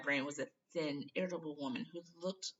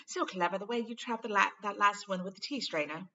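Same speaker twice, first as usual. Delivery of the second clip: lightly higher, eager praise; eager schoolgirl; 5 semitones higher, 5 dB louder than usual. Acted. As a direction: gush a little over his book. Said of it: "said Hermione eagerly".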